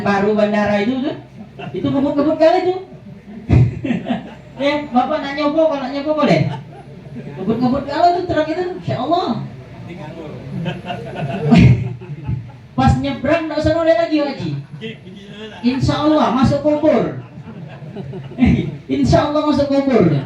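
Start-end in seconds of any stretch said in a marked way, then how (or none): 1.7-9.4 joking through chuckles about speeding trucks; laughing, fast teasing joke. Real, not acted. 15.7-20.1 punchline broken by laughter; chuckling mock blessing; laughing joke. Real, not acted.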